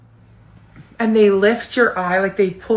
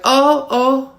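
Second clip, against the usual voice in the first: startled gasps; 7 semitones higher, 5 dB louder than usual. Retold or imitated. Reacting to needle in eye.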